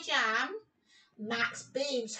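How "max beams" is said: plain reading voice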